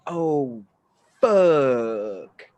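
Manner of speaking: slow as hell. Retold or imitated. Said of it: "slow as hell".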